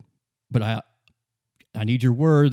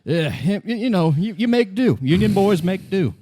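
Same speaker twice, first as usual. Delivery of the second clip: rising, louder brag; excited boast; 6 semitones higher, 4 dB louder than usual. Acted. Merc bragging to the officer, louder and higher. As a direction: pump it up, proud of the crew. Boasting about hauling the body.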